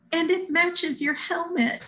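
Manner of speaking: high, slow and gentle; a touch of sweet volunteer; sweet kind voice. Retold or imitated. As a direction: soothe her like a kind helper. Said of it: "she was so sweet".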